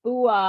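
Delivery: loud gruff "ooh, ah" croak; gruff potoo call. Shown as a demonstration. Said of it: "describes it as, quote, "a fairly loud, gruff".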